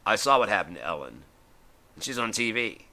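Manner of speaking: ordinary delivery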